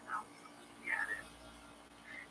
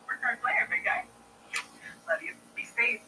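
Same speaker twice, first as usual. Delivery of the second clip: usual speaking voice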